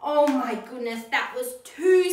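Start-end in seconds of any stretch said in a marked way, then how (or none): none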